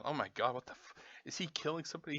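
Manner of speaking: hushed, quick mock alarm; quiet imitation of someone alarmed; imagined neighbor. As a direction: voice a worried neighbor under your breath.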